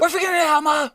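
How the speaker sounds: imitating a panicked daughter; frantic daughter. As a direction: snap back at mom, flustered. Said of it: "And Emily's like".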